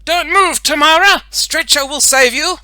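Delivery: high, loud rescue proclamation; heroic shout. A narrator's reading. Boy superhero rescues girl on monkey bars, heroic. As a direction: announce the rescue with full bravado.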